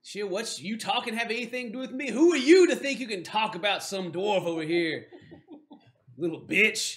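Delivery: lightly voiced indignant dwarf; challenging dwarf. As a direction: dress the human down as a cocky dwarf.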